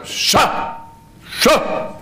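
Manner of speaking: hissed Scha, high and breathy; stern voiceless shush; sharp shushing. Retold or imitated. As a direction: hush someone noisy with a sharp Scha. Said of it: "und er sagt, Scha!".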